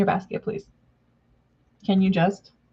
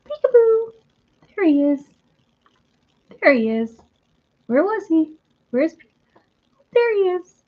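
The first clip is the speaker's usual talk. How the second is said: much higher, louder sing-song reveal; high delighted peekaboo voice; peekaboo baby talk; 10 semitones higher, 3 dB louder than usual. Retold or imitated. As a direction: squeal it like finding a hiding toddler. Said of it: "you go".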